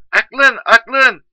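very high, loud screech of a question; mocking wife. Wife ridiculing her husband's wisdom, high mocking shout. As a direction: shriek it back down the phone.

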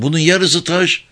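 high-pitched, dismayed surprise; astonished. A narrator's reading. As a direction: exclaim it in disbelief. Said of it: "diye şaştı".

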